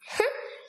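short, sudden laugh; brief amused outburst; burst of laughter. Acted. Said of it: "eut un nouvel éclat de rire". (bref éclat de rire)

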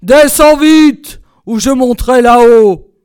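slow, booming angry order; roaring tyrant husband; full-force shouting. A narrator's reading. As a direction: bellow a threat up the stairs. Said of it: "criait de toute sa force".

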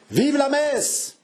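high, drawn-out rallying shout; delirious crowd cry. A narrator's reading. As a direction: chant it like a frenzied mob, stretching the words. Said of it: "répétait la multitude qui délirait". (cri de foule en délire)

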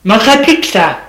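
loud angry retort shouted through the door; shouting. A narrator's reading. Husband shouts at his wife through the door, loud and angry. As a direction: yell it back at her, bitter. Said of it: "Il lui crie".

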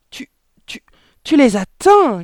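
stammered whisper bursting into a high shriek; horrified yelp. A narrator's reading. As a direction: choke on the start, then yelp it. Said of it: "glapit la mère". (bégaiement étouffé puis glapissement aigu)

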